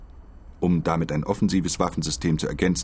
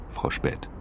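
whispered; 4 dB quieter than usual. Acted. Whispered name.

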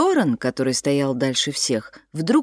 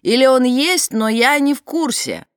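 shouting over wind; 8 semitones higher, 5 dB louder than usual. A narrator's reading. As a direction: voice pitched up to carry. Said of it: "прокричал Фрэнсис сквозь ветер".

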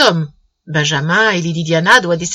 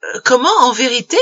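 high-pitched, incredulous questioning; astonished; 5 semitones higher than usual. A narrator's reading. Nephew amazed at his uncle's offer, astonished questioning. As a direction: stammer in sheer astonishment. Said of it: "saisi d'étonnement".

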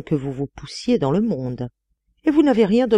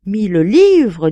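loud, high, stretched second word; emphatic amazement; 4 semitones higher, 8 dB louder, slower than usual. A narrator's reading. Artist astounded by the price, exclaiming. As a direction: swoop up on the sum in amazement. Artist marvels again, louder.

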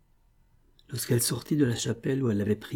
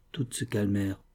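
ordinary delivery, nothing marked

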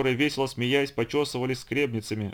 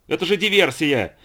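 loud, high, outraged shouting; full-voiced furious tirade; sudden yelling; 5 semitones higher, 7 dB louder than usual. A narrator's reading. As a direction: bellow threats in alarm. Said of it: "И вдруг он завопил".

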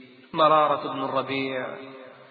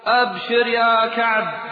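loud, raised, drawn-out announcement; crier's glad shout; 5 semitones higher, 5 dB louder, slower than usual. Acted. Crier bringing Kaab good news, loud slow call. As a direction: proclaim good news at a high pitch. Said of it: "صارخ ينادي من بعيد".

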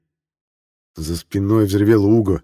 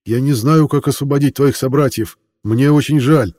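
higher and louder, apologetic; raised voice; 6 semitones higher, 4 dB louder than usual. A narrator's reading. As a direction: call out an apology. Hero answers dragon aloud.

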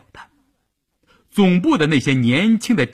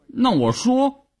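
slow, higher, sulky giving in; reluctant surrender; 12 semitones higher, slower than usual. Acted. Wife gives in to her husband, slow and sulky. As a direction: give in slowly, still sulking.

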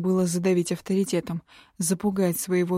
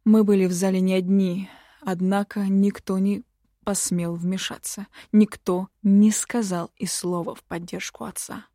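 usual speaking voice

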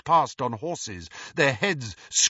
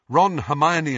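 ordinary delivery

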